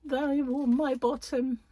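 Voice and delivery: high, far put-on voice of someone clinging to her boxes; mock whiny voice. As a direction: play a petulant hoarder, high and squeaky. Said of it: "It'll be like".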